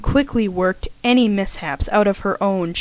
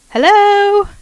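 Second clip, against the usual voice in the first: bright, much higher and louder hello called out; cheerful greeting; 13 semitones higher, 8 dB louder than usual. Acted. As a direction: sing out a happy hello to your roommate. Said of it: "The cheerful voice of her roommate".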